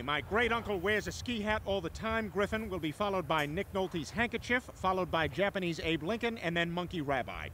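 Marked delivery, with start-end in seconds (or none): none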